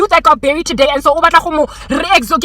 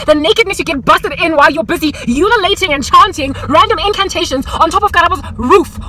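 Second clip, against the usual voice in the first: ordinary talk, nothing marked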